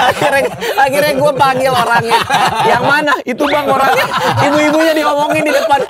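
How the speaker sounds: loud, high telling through laughter; laughing excitedly. Real, not acted. Comedian telling how he called the audience member up, high laughing voice. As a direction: crack up while telling it, pitch way up. Cracking up at the ibu-ibu story.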